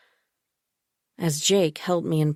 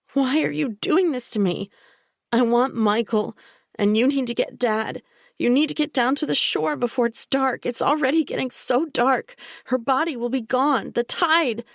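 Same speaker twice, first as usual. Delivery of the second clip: higher, urgent pleading to be believed; desperate pleading; 8 semitones higher than usual. A narrator's reading. The narrator begging Bella and Jake to get Dad to the shore, desperate. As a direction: beg them before it gets dark.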